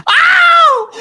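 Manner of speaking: high loud mock scream; Wilhelm scream. Friends riffing on sound effects, mock Wilhelm scream. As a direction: belt out a hammed-up movie scream. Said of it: "Wilhelm scream".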